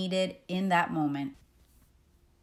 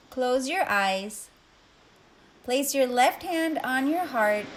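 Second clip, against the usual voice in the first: guided meditation; 4 dB quieter than usual. Acted. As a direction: ease the listener into calm, one breath at a time. Leads a breathing meditation.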